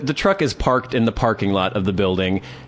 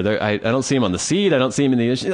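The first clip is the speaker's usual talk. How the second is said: ordinary delivery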